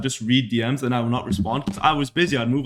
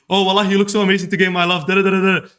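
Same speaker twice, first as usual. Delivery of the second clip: quick, higher-pitched effusive greeting; super friendly gushing; 5 semitones higher, 3 dB louder than usual. Acted. Overdoing compliments at a first meeting, super friendly gushing. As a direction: gush compliments in a rapid, raised voice. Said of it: "they think I'm being super friendly".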